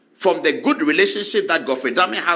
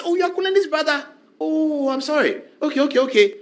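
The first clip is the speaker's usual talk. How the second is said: higher, eager surprise turning apologetic; mock surprised; 8 semitones higher than usual. Attorney General greeting the judge's cousin, mock surprised and eager. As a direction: brighten up at the name, then fall over yourself.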